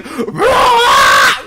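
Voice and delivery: one high loud trumpeting yell; imitating her elephant shout; mock elephant cry. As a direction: blast a single elephant cry. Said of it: "She's like".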